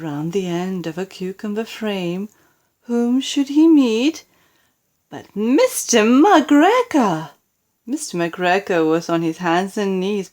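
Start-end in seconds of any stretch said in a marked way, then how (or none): 2.9-7.3 slow, loud and high build to the name; dramatic reveal. A narrator's reading. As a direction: land the reveal big and slow.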